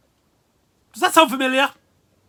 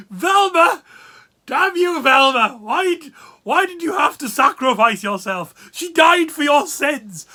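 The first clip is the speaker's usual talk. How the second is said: mock lament; 7 semitones higher, 7 dB louder than usual. Mock lament for Velma's sacrifice.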